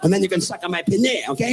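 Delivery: normal talking voice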